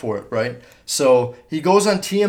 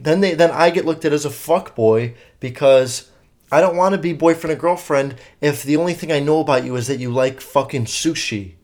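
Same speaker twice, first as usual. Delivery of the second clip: normal talking voice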